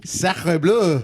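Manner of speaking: mock French exclamation. Joining the French joke.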